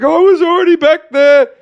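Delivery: high, loud mock call while scurrying away; hammed-up high-pitched yell from someone escaping; imitated fleeing culprit. Acts culprit scurrying off into distance.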